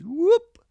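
high, loud yelp of a whoop; a sudden high whoop yelp; imitated whoop. Imitating the kid's loud whoop at Dunkin' Donuts. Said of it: "He does his whoop sound".

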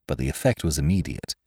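usual speaking voice

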